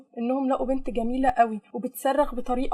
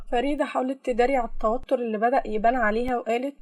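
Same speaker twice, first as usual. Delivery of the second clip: ordinary delivery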